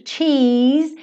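usual speaking voice

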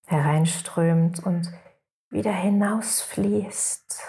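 guided meditation. Acted. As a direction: guide the listeners into rest, unhurried.

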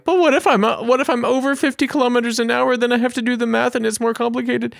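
squeaky high mock teen fretting over the math; mock panicky teen driver. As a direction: play a fretting seventeen-year-old learner. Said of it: "to imagine being seventeen and you're like".

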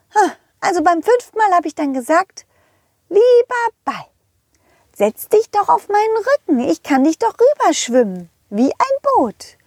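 squeaky high cartoon horse calling out. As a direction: play a cheerful high-pitched pony. Rosa the horse tells story. (hohe quietschige Pferdestimme)

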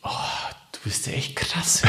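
amazed whisper. Real, not acted. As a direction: breathe it out in awe. Astonished aside to co-host.

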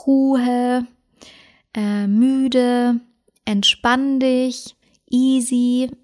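drawn-out, soothing cue words; calm soothing tone. Shown as a demonstration. Dog owner demonstrating calming cue words, soothing and drawn out. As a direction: stretch each word out gently to settle a dog. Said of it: "oftmals auch etwas langgezogen".

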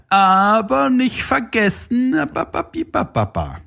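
high, slow sing-song scolding; wagging-finger tease. Shown as a demonstration. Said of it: "wie ich das in echt so mache".

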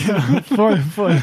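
laughing through the words; high, loud giggly agreement. Real, not acted. Laughing at the GTA comparison.

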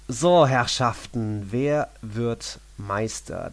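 slow, loud announcer voice; dramatic announcer. Acted. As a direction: drag it out like a game show host. Host opens the title question.

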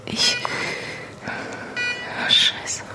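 breathless, hushed horror; shocked whisper. Acted. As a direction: gasp it out in shock. Schock beim Anblick der Verletzten. (entsetztes Flüstern)

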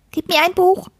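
high-pitched lisping little creature; lisping furry creature. A narrator's reading. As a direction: demand it as a squeaky, lisping furball. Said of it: "lispelte das Pelzschwein".

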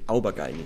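"Aubergine" said as ow-ba-GUY-nee; deliberate wrong pronunciation. Shown as a demonstration. Explaining the channel's joke, "Aubergine" mispronounced as ow-ba-GUY-nee. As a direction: misread "Aubergine" with ow at the start and GUY-nee at the end. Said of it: "sprechen sie es einfach falsch aus".

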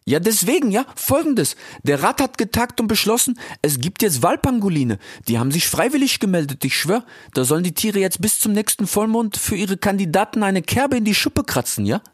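high, cheeky youth voice; Ilias. Acted. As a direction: call out playfully, very high. (frecher Ilias, hoch und laut gerufen)

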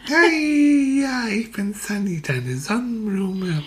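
hohe, langsame Blumenstimme; Sonnenblume spricht. Acted. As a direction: freundlich flüsternde Blume. (Sonnenblume begrüßt Manfred freundlich, hoch und langsam)

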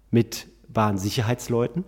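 ordinary delivery

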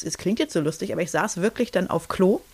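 usual speaking voice

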